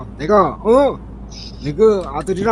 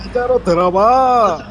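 higher, louder and slow; to someone hard of hearing; 6 semitones higher, 4 dB louder than usual. Shown as a demonstration. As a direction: say it up and slowly so they can hear. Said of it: "잘 안 들려".

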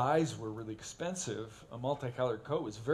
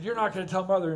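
louder, stress on not; louder mock threat; 6 semitones higher, 10 dB louder, faster than usual. Big brother pinning little brother, louder threat. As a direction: press the threat harder.

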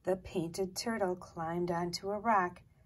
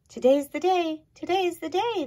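excited mice; 9 semitones higher, 8 dB louder than usual. A narrator's reading.

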